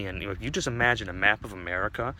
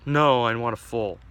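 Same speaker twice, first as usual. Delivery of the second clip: louder, firm refusal; insistent demand; 3 semitones higher, 8 dB louder than usual. Acted. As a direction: cut in and insist. Dick insists to bartender.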